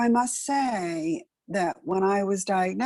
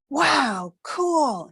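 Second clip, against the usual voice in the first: put-on high, thrilled little-boy wow; imitating a son's delighted wow; delighted kid; 8 semitones higher than usual. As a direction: squeal it like a kid who thinks it's great. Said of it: "He thought it was the coolest thing ever".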